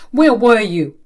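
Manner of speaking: loud, sharp demand; angry demand. Acted. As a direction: bark it at her. Angry father confronts daughter.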